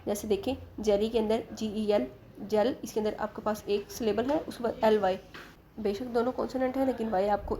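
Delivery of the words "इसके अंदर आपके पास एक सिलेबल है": ordinary delivery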